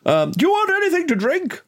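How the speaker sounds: very high-pitched, eager offer; solicitous host. Acted. Host offers drinks to his visitors, high eager voice. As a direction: pipe up with an offer to guests.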